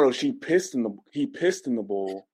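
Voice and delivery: normal talking voice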